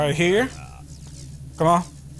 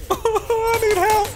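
laughing plea; 13 semitones higher, much slower than usual. Real, not acted. Player overwhelmed alone in fight.

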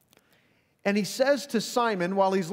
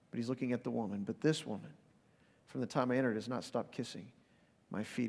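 tender quoted words; 4 semitones lower, 8 dB quieter than usual. Acted. Jesus quoted softly in sermon.

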